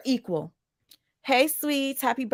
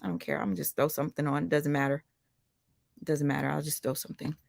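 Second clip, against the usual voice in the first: muttered to herself; 5 semitones lower, 7 dB quieter than usual. Real, not acted. Deciding on an outfit, muttering.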